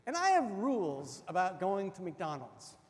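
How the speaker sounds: usual speaking voice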